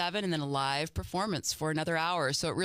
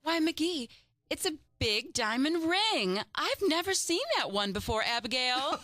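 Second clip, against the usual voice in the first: Molly gushing; 9 semitones higher than usual. Acted. Molly admiring the neighbor's ring, gushing. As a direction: gasp over the ring with delight. Plays Molly in radio scene.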